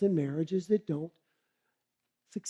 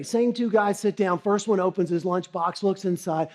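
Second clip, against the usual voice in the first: ordinary talk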